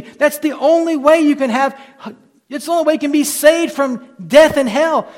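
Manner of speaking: loud, high, driving pulpit cry; preaching at full force. Acted. Preacher pleading with sinners, preaching at full force. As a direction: hammer home the only way to be saved.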